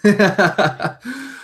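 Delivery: amused laugh, rising at the end; real laugh. Real, not acted. Co-host reacting to the ad-money joke, high chuckle.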